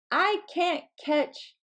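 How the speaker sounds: ordinary talk, nothing marked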